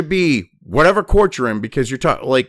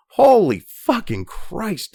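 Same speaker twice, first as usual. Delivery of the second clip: slow exasperation; 5 semitones higher, much slower than usual. Real, not acted. Exasperated at the article.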